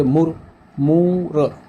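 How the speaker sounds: मुर short, then मूर with a long drawn ū; short then long vowel. Shown as a demonstration. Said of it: "अ का ये जो है स्वर आ रहा है ना".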